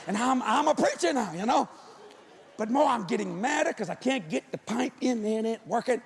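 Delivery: ordinary talk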